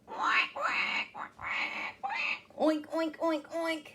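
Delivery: soft, snorting pig oinks; Mama Pig oinks to Hedgie; oinking pig. Acted. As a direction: oink quietly like a pig. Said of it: "said Mama Pig".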